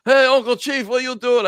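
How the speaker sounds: put-on high, loud Russian-accented wrestler voice; Nikolai voice. Retelling a prank call, high loud Nikolai voice. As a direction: mimic the old friend answering the phone. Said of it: "He's like".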